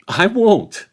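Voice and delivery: high, loud, lofty rebuff; dismissive Frodo. A narrator's reading. Frodo refusing the sheriffs' orders, dismissive and loud. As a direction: brush the officials off grandly.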